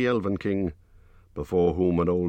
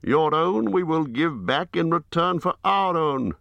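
Bard the bowman; 11 semitones higher, 4 dB louder than usual. A narrator's reading. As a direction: raise the voice high for a grave herald-like Bard. Bard answers.

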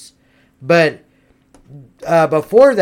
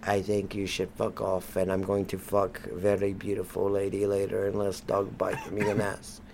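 much lower, far softer voice of Russian Ivan; 11 semitones lower, 17 dB quieter than usual. Pretend. Ivan answers the American patriot, low and quiet. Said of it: "Ivan, come here".

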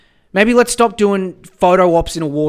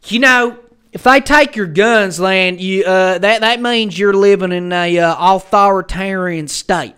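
loud, higher-pitched mock American gun-rights voice; mock American gun defender; 4 semitones higher, 7 dB louder, slower than usual. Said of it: "over there they're like".

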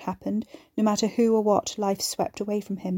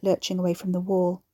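plain reading voice

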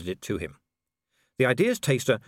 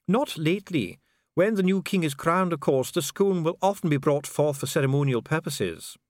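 higher-pitched, formal official; precise official Dee; 8 semitones higher than usual. Acted. Official explains the mechanism to visitor, formal.

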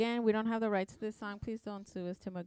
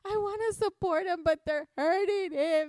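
higher, louder, slow pleading; retold tearful protest; tearful plea; 6 semitones higher, 5 dB louder than usual. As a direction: sob out the plea to stop. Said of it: "I was like".

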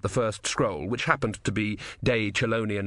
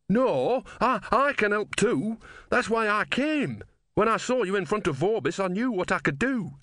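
much higher, stammering plea; pleading young man; 11 semitones higher than usual. Acted. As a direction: protest eagerly, high and insistent. Voices a young man protesting.

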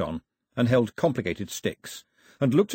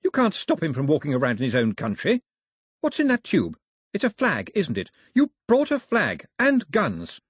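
put-on high, louder young woman, accusing; indignant Daphne; 5 semitones higher, 3 dB louder than usual. A narrator's reading. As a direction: accuse her father of bringing guns.

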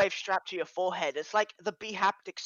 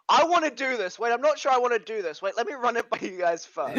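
higher, louder hesitant impression; imitating friend; 6 semitones higher, 5 dB louder than usual. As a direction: mimic a second-guessing friend. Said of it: "I like your thought pattern there".

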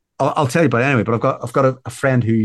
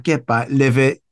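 usual speaking voice

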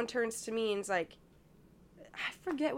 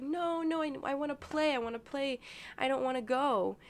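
higher-pitched child's protest, retold; imitated little girl asking; 5 semitones higher than usual. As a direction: play a small child asking to stay. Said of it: "not begging, but, like, asking the grandma".

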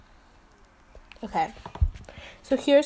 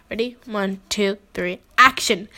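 ordinary talk, nothing marked

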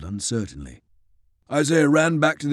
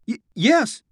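stammering fear; 10 semitones higher than usual. Acted. James dumbstruck, stammering.

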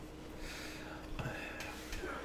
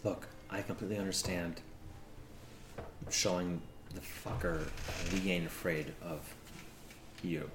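soft reassurance; 12 semitones lower, 16 dB quieter than usual. Acted. Soft reassurance after argument.

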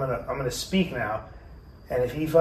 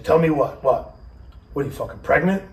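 retold angry father; 3 semitones higher, 5 dB louder than usual. Father confronts pregnant daughter, retold.

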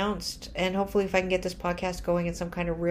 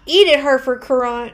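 loud, higher gloating jeer; mock taunt; 6 semitones higher, 9 dB louder than usual. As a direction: taunt the newspaper loudly. Taunting the old critic.

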